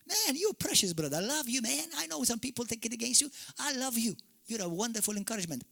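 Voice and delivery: high, affectionate address; gushing praise. Pretend. Preacher pretending to praise Joel Osteen, high and gushing. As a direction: coo at him like a dear friend.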